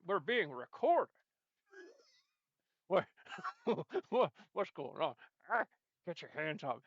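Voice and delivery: ordinary talk, nothing marked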